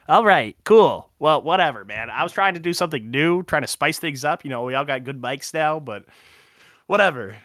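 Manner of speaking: annoyed. Real, not acted. Annoyed after being told stop.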